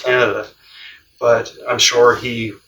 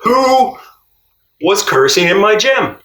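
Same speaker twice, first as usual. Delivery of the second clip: high mock yell, louder; retold coach yell; 9 semitones higher, 4 dB louder, slower than usual. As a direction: shout it up high like the coach did. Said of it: "this is what he said to me".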